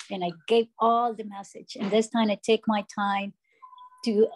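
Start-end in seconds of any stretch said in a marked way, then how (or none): none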